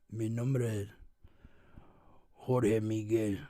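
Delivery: low, soft, slow Spanish gangster line; Narcos voice. Retold or imitated. Narcos impression, low and soft. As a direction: murmur it like a cartel boss. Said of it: "I sound like".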